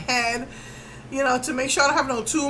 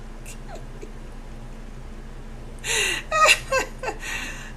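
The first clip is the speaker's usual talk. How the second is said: high breathless laugh breaking into a sob; laugh-cry; 11 semitones higher, 5 dB quieter than usual. Real, not acted. Remembering her MRI fear, a whispered laugh that turns to crying. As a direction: let the nervous laugh crumble into tears.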